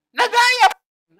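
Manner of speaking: high, slow and loud on "Nagaya"; emphatic. Acted. Preacher telling the congregation the magicians copied it again, high and slow emphasis.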